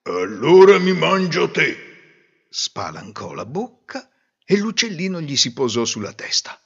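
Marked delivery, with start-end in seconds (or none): 0.0-2.5 wolf voice. Acted. Wolf threatens the bird.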